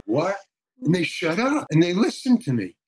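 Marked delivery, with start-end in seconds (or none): 0.0-0.4 high, short mock-startled question; taken-aback crowd. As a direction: imitate a crowd caught off guard, pitched way up. Imitates the stunned crowd's reaction.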